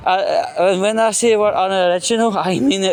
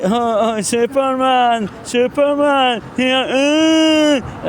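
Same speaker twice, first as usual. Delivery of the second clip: higher, sing-song jeering chant of Superman; mocking taunt; 6 semitones higher, much slower than usual. Imitating the boys who taunted him at the station, higher sneering sing-song. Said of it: "doing that noise at me".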